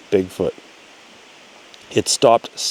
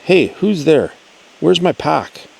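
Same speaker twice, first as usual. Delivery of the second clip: retold yelling; 7 semitones higher than usual. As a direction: call out sharply, searching for the thief. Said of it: "now I'm kinda yelling".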